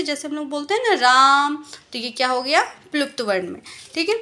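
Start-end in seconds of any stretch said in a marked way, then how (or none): 0.9-1.6 राम called out loud with the vowel held long; calling out Ram. Shown as a demonstration. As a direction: call राम across the room, stretching the आ. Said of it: "तो पुकारने में कैसे?". (पुकारते हुए लंबा राम)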